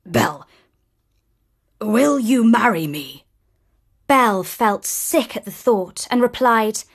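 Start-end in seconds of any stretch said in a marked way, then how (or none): 0.1-3.3 gruff. Acted. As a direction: ask it gruffly. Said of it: "asked gruffly".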